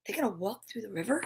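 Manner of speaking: very soft and fast; quick hushed aside. Real, not acted. Reader quietly doubting the river crossing. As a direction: mutter it quickly under breath.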